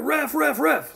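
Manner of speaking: high mock yell for the ref; high-pitched imitated shout of "ref"; kid calling the ref. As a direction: call out like a kid who needs the ref. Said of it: "kids, like, screaming for my brother".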